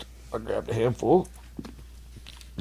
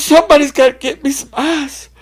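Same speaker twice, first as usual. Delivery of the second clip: high panicked cry; 9 dB louder than usual. Retold or imitated. Drunk telling friends he's numb, high wailing cry. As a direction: cry it like you're scared of dying. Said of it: "He goes".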